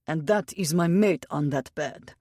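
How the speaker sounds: put-on Russian accent, words swamped by emotion; thick Russian accent. A narrator's reading. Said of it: "His accent was thick with his Russian tongue".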